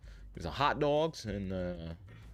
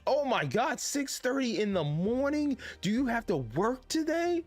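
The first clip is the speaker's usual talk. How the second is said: high, astonished outcry at the early hour; real amazement, voice shooting up high; gasping Oh my God, pitched way up; shocked disbelief; 8 semitones higher than usual. Real, not acted. Shocked at a viewer's 6:30 wake-up.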